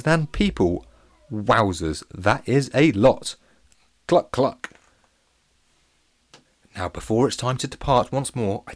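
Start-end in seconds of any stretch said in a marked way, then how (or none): 4.1-4.7 two short, higher clucks; playful mock chicken cluck. Narrator caps the chicken facts for listeners, clucking.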